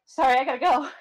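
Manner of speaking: mock-panicked and loud; flustered excuse. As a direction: blurt out an excuse to escape. Retells fleeing an awkward exchange.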